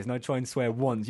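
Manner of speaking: ordinary talk, nothing marked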